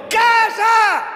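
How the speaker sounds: high, loud rebuking shout. Acted. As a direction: bark the command at the storm. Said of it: "le gritó". (grito fuerte y agudo de reprensión)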